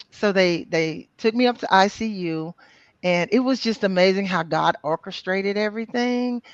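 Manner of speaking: ordinary talk, nothing marked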